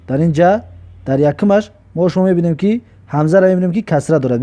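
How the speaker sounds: normal talking voice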